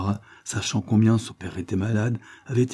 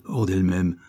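usual speaking voice

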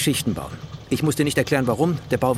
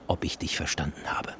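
menacing whisper; 8 semitones lower, 8 dB quieter than usual. Acted. Party man whispering a threat at the engineer. Threat after slow line.

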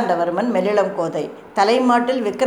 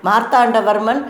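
ordinary delivery

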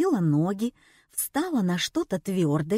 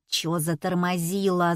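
plain reading voice

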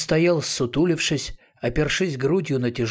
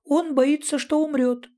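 very high, squeaked cartoonish voice; high-pitched squeaky character voice; squeaky little creature. A narrator's reading. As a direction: pipe it up in a tiny high squeak. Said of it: "пропищал Ятуркин Джансерхив".

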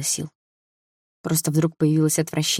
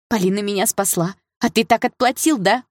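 high, raised accusing outburst; crying out; 6 semitones higher, 3 dB louder than usual. A narrator's reading. Sister confronts brother who betrayed her rescuer, crying out. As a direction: hurl the reproach at him, pitched up. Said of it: "выкрикнула Саша".

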